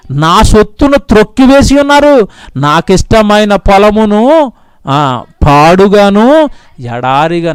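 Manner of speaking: slow, drawn-out reading; mournful slow reading of God's lament; slow grieving verse. Acted. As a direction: drag the words out in sorrow. Reads verse slowly.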